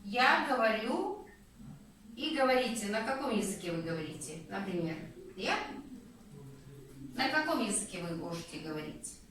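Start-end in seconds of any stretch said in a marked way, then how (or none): none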